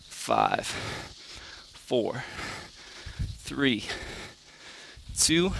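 quiet, strained counting mid push-up; counting workout reps. Real, not acted. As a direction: count reps under your breath while working. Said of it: "I'm gonna count it out for you too".

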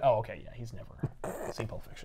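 soft, fading to a whisper; dry muttered realization. Retold or imitated. Host learning Spider-Man's taste, dry muttered realization. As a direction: murmur it knowingly. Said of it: "I was like".

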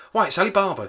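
high, loud delighted cry; excited exclamation. A narrator's reading. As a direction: burst out in boyish excitement. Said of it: "Scrooge exclaimed the next thing".

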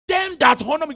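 high, loud call. Acted. Preacher calling the verse to the church, loud. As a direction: fling the phrase out.

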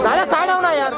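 high-pitched shout across a crowd; calling out. Real, not acted. Asking a man to come aside, calling out. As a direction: call out to get someone moved.

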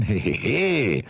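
nervous fake chuckle; forced laugh. A narrator's reading. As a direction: laugh it off awkwardly. Husband fakes a laugh. (gezwungenes Lachen)

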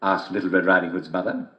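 plain reading voice

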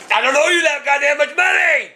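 dad yelling at the phone agent, angry shout. Real, not acted. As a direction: let the frustration burst out. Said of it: "Dad, stop yelling".

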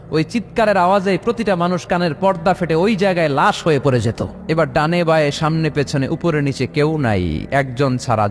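ordinary talk, nothing marked

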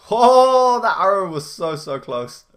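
loud high startled cry; surprised shout. Real, not acted. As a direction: shout as the arrow whizzes by. Arrow narrowly missed him.

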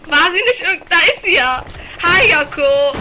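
high, loud cries of recognition; excited alarm. Real, not acted. Gamer greets the zombie Yoko, high excited shouting. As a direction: call out loudly as you spot her.

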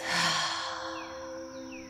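long, airy sigh out through the mouth; slow cleansing out-breath; demonstrated exhale. Shown as a demonstration. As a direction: let the breath go in a soft open-mouthed sigh. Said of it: "Exhale through the mouth".

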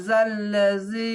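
জাল্লাজি with the long vowel drawn out slowly; tajweed madd demo; stretched vowel. Shown as a demonstration. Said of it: "আকার স্টেনে পড়বেন".

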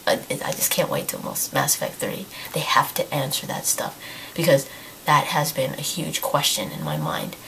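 hoarse, whispery losing voice; barely any voice left, airy. Real, not acted. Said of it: "I'm pretty much losing my voice".

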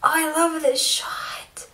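slow, hushed whisper of awe; awed whisper. Real, not acted. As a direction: breathe it as if spellbound. Awed by shot.

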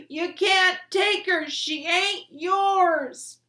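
very high, loud, slow scream; furious scream. A narrator's reading. Son screaming at his father taking the body, furious. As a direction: scream it at him with everything. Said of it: "Bryce screamed".

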